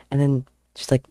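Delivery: ordinary talk, nothing marked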